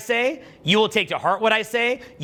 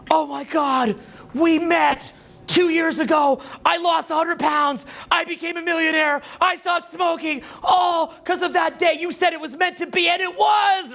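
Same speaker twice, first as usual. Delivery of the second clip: ecstatic fan; 12 semitones higher, slower than usual. Retold or imitated. Grateful stranger meets him again, high ecstatic shouting. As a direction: gush like a convert. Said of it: "comes up to me randomly on the street later and is like".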